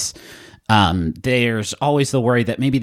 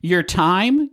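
usual speaking voice

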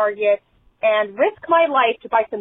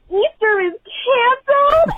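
very high imitated tearful child; mock child wail; 12 semitones higher, 4 dB louder than usual. Parent imitating three-year-old hearing the news, mock wail. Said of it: "and starts crying".

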